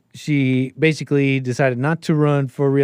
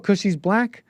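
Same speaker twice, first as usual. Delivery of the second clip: high-pitched incredulous question; exaggerated questioning echo; mock disbelief; 7 semitones higher, slower than usual. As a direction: imitate someone taken aback, pitch jumping up. Repeats the incredulous reaction, higher.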